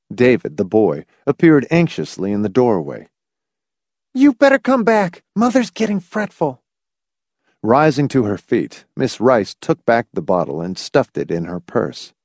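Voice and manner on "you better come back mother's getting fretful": put-on high-pitched young character voice; boy's voice. David the boy calling them back from the doorway, high boy's voice.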